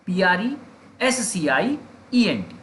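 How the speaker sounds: "P R E, S C I, E N T" in three groups with pauses; spelled in chunks. Shown as a demonstration. Teacher repeating for memory, "P R E S C I E N T" broken into three parts. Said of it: "तीन हिस्सों में हमने इसे तोड़ दिया".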